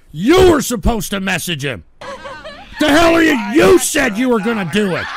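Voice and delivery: yelling at a chatter who didn't follow through; angry scolding shout. Real, not acted. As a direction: chew him out for not doing it.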